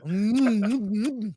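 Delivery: amorous French cartoon skunk murmur; Pepe Le Pew. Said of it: "I always think of Pepe Le Pew".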